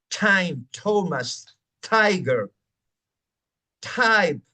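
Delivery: "time", "Thomas", "tiger", "type" each with a puffed English T; aspirated T demo. Shown as a demonstration. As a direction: blow the paper with every T. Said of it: "Es explosivo".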